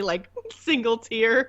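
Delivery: ordinary talk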